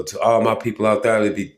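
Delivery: usual speaking voice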